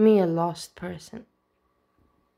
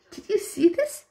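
much higher, thrilled squeal on "you"; delighted squeal; 14 semitones higher than usual. Real, not acted. Fan gushing to viewers about a sweet YouTuber, high delighted squeal. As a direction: rise up high on "you" as if you can't contain it. Rewatching clip, gushing over Anne.

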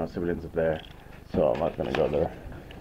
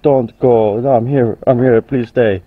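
voicing dog; 12 dB louder, slower than usual. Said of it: "she's like".

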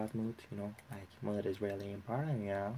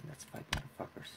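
whispered taunt; 7 dB quieter than usual. Real, not acted. Taunting the game opponents.

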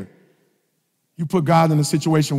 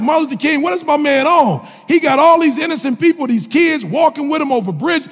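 louder, higher, exasperated arguing; incredulous retold rant; 11 semitones higher, 4 dB louder than usual. Preacher replaying his own rant at King, loud and incredulous. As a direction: push back hard, like you can't believe it.

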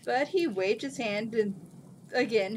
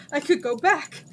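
louder, higher delighted cry; joyful shout; 6 semitones higher, 8 dB louder than usual. A narrator's reading. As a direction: repeat it, overjoyed. Jin repeats in joy.

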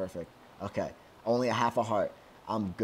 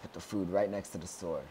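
ordinary talk

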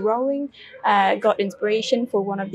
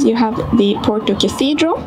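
ordinary delivery

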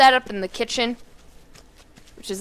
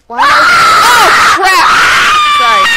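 very high, full-volume shriek; panicked scream; 13 dB louder, slower than usual. Real, not acted. Player suddenly stuck in the game, panicked high scream. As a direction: break off into screaming.

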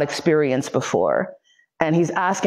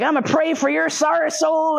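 high-pitched imitation of zealous praying; mock preacher; 10 semitones higher than usual. Man hitting captive with a Bible, high mock preaching.

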